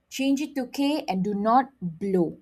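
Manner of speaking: ordinary talk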